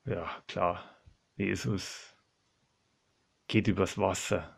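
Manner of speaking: low, soft disbelieving aside; sceptical thinking aloud. Acted. Petrus doubts it is Jesus on the water, quiet sceptical thought. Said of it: "Denkt sich der Petrus".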